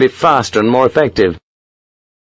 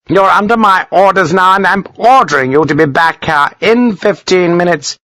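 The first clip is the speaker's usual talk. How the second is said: high, forceful order; angry command; 9 semitones higher than usual. A narrator's reading. Colonel ordering a defiant lieutenant, angry. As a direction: pull rank in a raised pitch.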